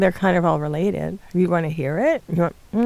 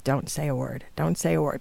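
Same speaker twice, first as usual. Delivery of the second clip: low, softer retold warning; recalled hush to a partner, pitched down; 4 semitones lower, 6 dB quieter than usual. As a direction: quote a discreet warning under your breath. Nudging fiancé to keep quiet.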